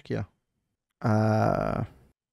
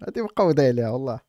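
usual speaking voice

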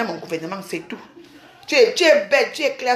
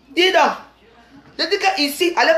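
angry outburst; 7 semitones higher, 3 dB louder than usual. Real, not acted. Angry rant at online rival.

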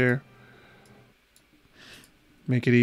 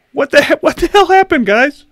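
high, loud shocked yell; stunned outcry at sudden disaster; startled outburst; 14 semitones higher, 9 dB louder than usual. Real, not acted.

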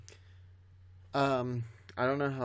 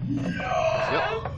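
high panicked scream; 8 dB louder than usual. Real, not acted. Screaming at jumpscare.

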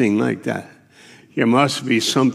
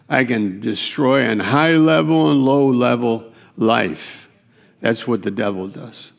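normal talking voice

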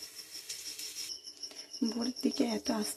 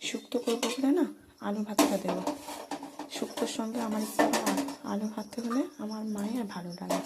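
normal talking voice

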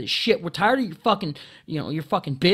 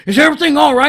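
much higher, louder concerned question; manager asking; 9 semitones higher, 12 dB louder, much faster than usual. Retold or imitated. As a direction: main manager checking in, mock alarm. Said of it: "she said".

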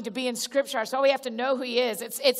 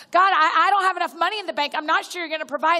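anxious prayer; 5 semitones higher, 6 dB louder, faster than usual. Retold or imitated. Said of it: "and then you go like".

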